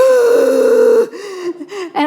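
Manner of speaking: loud, high sobbing gasp demonstrated; a sharp crying gasp pulled in hard; wailing gasp. Shown as a demonstration. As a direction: gasp out a wail as if overcome. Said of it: "move my microphone and show you".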